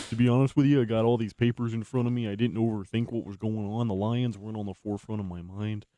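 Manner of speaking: talking around chewing gum; mouth full. Real, not acted. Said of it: "I got some gum in my mouth".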